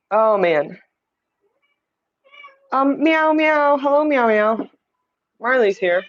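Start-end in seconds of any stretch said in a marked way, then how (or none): 2.7-4.7 high, lilting cat meows; playful meowing. Pretend. Host greets her cat with high playful meows. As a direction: meow sweetly at the cat like a cat.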